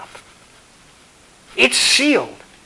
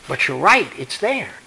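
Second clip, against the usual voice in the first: ordinary talk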